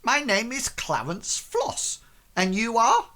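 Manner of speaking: high-pitched, formal merchant introduction; haughty merchant. Acted. Merchant giving his name to the crew, high and haughty. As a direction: introduce yourself grandly, then turn the question back.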